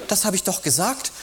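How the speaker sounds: high, annoyed retort; mildly irritated girlfriend. Retold or imitated. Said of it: "erwidert sie gereizt".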